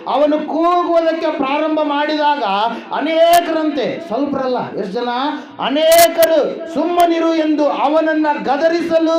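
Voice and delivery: high, loud, drawn-out proclamation; stretched, ringing preacher's cry; full-force preaching. Acted. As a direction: belt out the scripture to the crowd.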